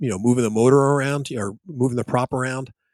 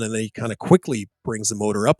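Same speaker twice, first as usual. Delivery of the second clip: ordinary talk, nothing marked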